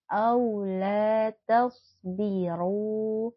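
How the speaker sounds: slow melodic tartil chant; slow, drawn-out Quranic recitation. Shown as a demonstration. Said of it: "Quran recitation demo".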